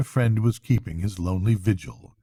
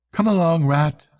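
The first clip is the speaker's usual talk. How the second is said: higher, carrying call; 6 semitones higher, 3 dB louder than usual. A narrator's reading. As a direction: call across to a friend lingering behind. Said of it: "called the Mole".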